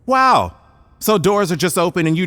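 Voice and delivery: high, loud mock amazement; sarcastic wow. Scientist mocking the detective's excuse, sarcastic. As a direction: drip with sarcasm.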